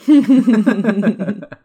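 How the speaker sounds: high, loud burst of laughter. Real, not acted. Big laugh at scene.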